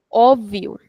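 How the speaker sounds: "Óbvio" with a long open first o; extended o. Shown as a demonstration. Teacher demonstrating the accented vowel, long open o. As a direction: hold the opening o of "Óbvio". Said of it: "we are extending the sound of o".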